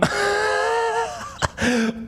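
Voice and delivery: high shrieking laugh. Real, not acted. Laughing in disbelief.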